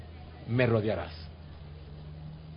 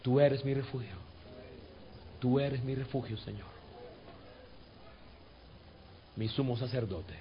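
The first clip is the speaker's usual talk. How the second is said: fading to a soft, hushed murmur; inward prayer; 3 semitones lower, 10 dB quieter than usual. Shown as a demonstration. Pastor praying to the Lord from the spirit, hushed. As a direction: speak it from deep inside. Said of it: "dilo con tu espíritu allá adentro".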